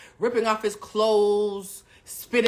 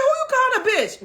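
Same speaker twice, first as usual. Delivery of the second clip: much higher, louder outraged shriek; indignant yell; 6 dB louder than usual. Retold or imitated. She snaps back at the man who called her a bitch, shrieking. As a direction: fire it back, offended and ready to fight. Her furious comeback mid-fight.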